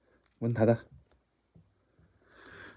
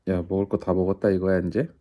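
ordinary talk, nothing marked

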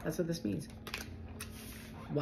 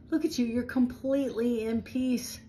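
delighted; 6 semitones higher than usual. Real, not acted. Higher, delighted.

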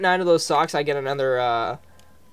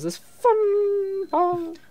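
fighting game announcer; much slower than usual. Pretend. Mock announcing the next section.

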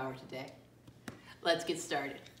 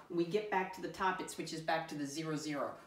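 usual speaking voice

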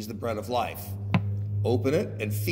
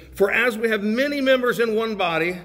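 loud, raised, measured preaching voice; scripture reading; 6 semitones higher, 6 dB louder than usual. Real, not acted. Preacher reading scripture to the congregation, ringing proclamation. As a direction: proclaim the verse phrase by phrase.

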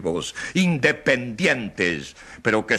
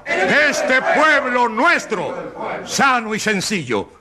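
slow, high, shouted oration; rousing harangue; 11 semitones higher, 4 dB louder, slower than usual. Acted. Lawyer haranguing the jury, slow high shout. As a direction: whip up the jury, word by word.

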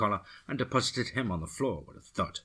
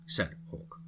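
usual speaking voice

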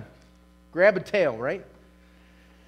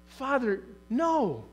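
impassioned preaching; 5 semitones higher than usual. Acted. Preacher pleading to God before his congregation, impassioned. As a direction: pour it out like a preacher at full force.

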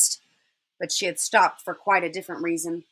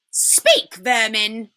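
commanding witch; much slower than usual. Acted. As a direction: order them to answer, with scorn. Witch orders animals to answer.

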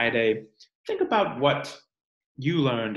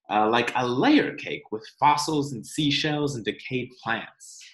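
put-on reading to children; 3 semitones higher than usual. Teacher reading the rock book to her class, storytime narration. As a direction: read it aloud for the class.

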